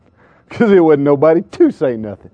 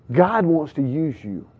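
soft, slow and deliberate; hushed earnestness; 3 semitones lower, 9 dB quieter, slower than usual. Real, not acted. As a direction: drop right down, giving each word room. Soft first take, then repeated emphatically.

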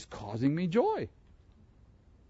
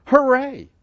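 inner hooray; 10 semitones higher, 9 dB louder than usual. Pretend. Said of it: "to say in your heart".

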